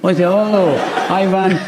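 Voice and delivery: usual speaking voice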